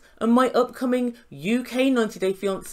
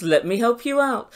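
ordinary delivery

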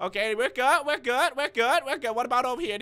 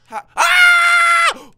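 long, piercingly high, loud scream; scream of fright; 14 dB louder than usual. Real, not acted. Player checks the bed, screams in fright. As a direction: scream at full volume.

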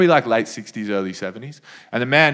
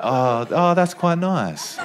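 slow pleased murmur; 3 dB quieter, much slower than usual. Shown as a demonstration. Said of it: "I've always wanted the crowd to just go".

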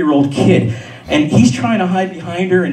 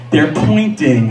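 normal talking voice

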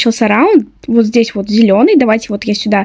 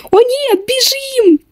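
panicked; 11 semitones higher, slower than usual. Pretend. Seeker spotted, fleeing.

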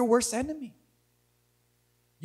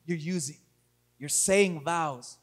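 low, hushed preaching with long pauses; solemn pastoral appeal; 11 semitones lower, 6 dB quieter than usual. Real, not acted. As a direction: speak softly and gravely to a hurting congregation. Sermon turns to soft, reflective appeal.

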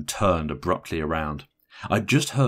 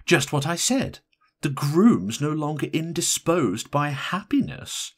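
higher, pointed inflection; familiar pointed inflection; 9 semitones higher, 3 dB louder than usual. A narrator's reading. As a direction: announce it slowly with pointed emphasis, pitched up. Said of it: "A familiar style of inflection was tack in".

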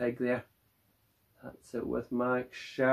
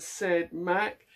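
usual speaking voice

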